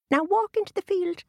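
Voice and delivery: high squeaky leprechaun voice; tiny fairy man, high and bossy. A narrator's reading. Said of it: "said the leprechaun".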